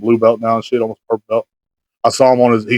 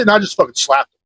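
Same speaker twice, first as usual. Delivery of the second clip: ordinary delivery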